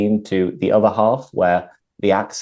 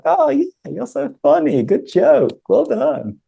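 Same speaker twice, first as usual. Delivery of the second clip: very high-pitched, amused and approving; laughing along. Shown as a demonstration. Teacher demonstrates laughing with someone, amused. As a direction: share the joke in a much higher voice. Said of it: "laugh with someone is like".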